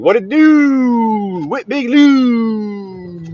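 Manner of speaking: slow, stretched high greeting; put-on greeting voice. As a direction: draw each word out like a hype man shouting out a friend. Hyped greeting to a chat viewer.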